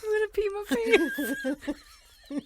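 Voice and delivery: squeaked out mid laughing fit. Real, not acted. Cohost cracking up after the snort, laughing.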